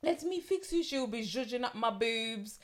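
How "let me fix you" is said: higher cooing French voice; a gentle French coo; French accent. Retold or imitated. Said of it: "pardon my French accent".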